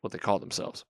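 ordinary talk

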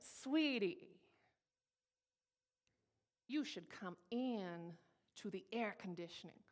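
drawn-out, pointed Southern-lady sweetness; sugary Southern order with a hard edge; nicely cursing out. Retold or imitated. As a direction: curse them out politely, stretching the words. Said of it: "nicely cursed out by a Southern lady".

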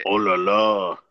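put-on French accent. Mock French exclamation.

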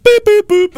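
high, loud boop boop beeps; imitated phone beeps. Young people using phones, high imitated beeps. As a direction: mimic a phone tapping out beeps.

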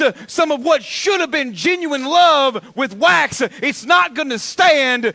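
loud, high, driving preacher voice; full-force preaching. Real, not acted. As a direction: preach it hard, drawing out the key words.

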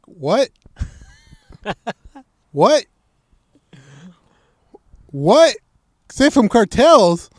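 high, loud disbelieving repeats; hammed-up shock at the danger; shocked disbelief. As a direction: stretch out safe from cartels in loud shock. Shocked at cartel warning.

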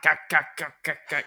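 high loud hammed-up choking gaks; rat choking. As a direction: choke out gak like a dying rat. Dying rat choking sounds.

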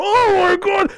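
high-pitched cry of shock; hammed-up horrified exclamation; mock horror. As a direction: wail it like a shocked bystander. Acting horrified onlooker at imagined beating.

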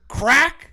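high loud mock crack of a beer can; sharp imitated can-opening sound. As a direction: pop the word like a can opening.

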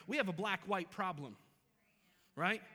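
ordinary talk

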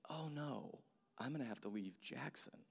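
hushed, retold dismay; imitating a kid's quiet dismay; sinking realization. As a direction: let the bad news settle in, softly. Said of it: "But then it begins to settle".

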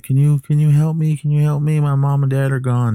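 put-on high, pleading child's voice; pleading little boy. The boy begs the wife to open the door, high and pleading. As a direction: beg like a scared little kid at the door. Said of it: "he's like".

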